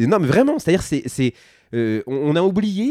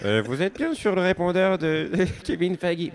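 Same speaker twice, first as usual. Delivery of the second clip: recorded voicemail greeting, higher; answering machine voice; 5 semitones higher than usual. Pretend. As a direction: put on an answering machine message voice. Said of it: "vous faites la voix du répondeur".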